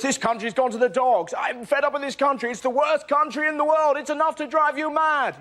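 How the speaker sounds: high, whining tirade climbing in pitch; mock ranting public. Angry Britons complaining about the country, mock exasperated rant. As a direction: moan in hammed-up exasperation. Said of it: "All you hear is".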